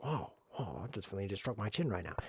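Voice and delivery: hushed, whispered mock awe; mock chin stroker. Mocking the chin strokers in most chess games, in a whisper. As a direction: whisper like a smug thinker stroking his chin. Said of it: "I only have chin strokers".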